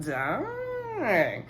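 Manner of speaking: long, high drawn-out dang; high-pitched stunned reaction. Retold or imitated. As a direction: stretch it out high in mock shock. Stunned reaction to ring taken back.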